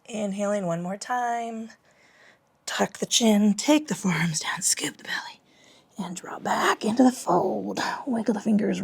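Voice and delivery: soft unvoiced whisper through the yoga cues; hushed, airy class instructions. Real, not acted.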